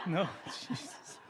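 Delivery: chuckling as the joke lands; laughing through punchline. Real, not acted. Poet laughing at his own mouse joke punchline. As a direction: laugh while delivering the punchline.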